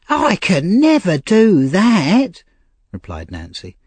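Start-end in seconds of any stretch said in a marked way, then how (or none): 0.1-2.4 high, slow, defiant refusal. Acted. Nancy refusing the old gentleman, defiant and high. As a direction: plant your feet and refuse her plea.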